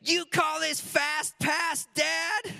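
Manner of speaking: put-on high, slow, shouted kid voice; kid's indignant yell. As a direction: yell it like an outraged little boy. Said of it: "he literally said these words".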